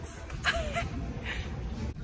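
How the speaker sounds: airy high giggle; breathy laugh. Real, not acted. Vlogger giggling at her friend's "what".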